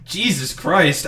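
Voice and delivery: loud, slow, drawn-out disbelief; stunned disbelief. Real, not acted. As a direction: let the shock hit you. Reacting to streamer's rant.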